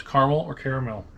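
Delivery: quick "caramel" as CAR-mul, then as CARE-uh-mel; two caramel pronunciations. Shown as a demonstration. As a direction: offer "caramel" both ways, short then long. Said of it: "Somebody tell us".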